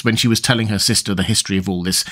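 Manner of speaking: plain reading voice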